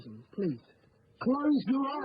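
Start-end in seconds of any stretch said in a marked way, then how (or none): 1.2-2.1 raised, high-pitched order; insistent command. Acted. Repeating a refused request, high and insistent.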